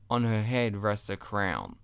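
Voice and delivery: plain reading voice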